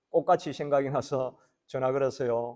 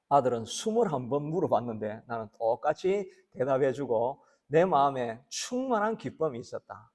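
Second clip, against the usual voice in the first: usual speaking voice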